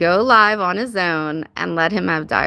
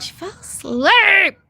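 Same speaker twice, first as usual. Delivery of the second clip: high, slow, stretched out in disbelief; incredulous repeat; 10 semitones higher, 4 dB louder, slower than usual. Real, not acted. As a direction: drag out the words in shock.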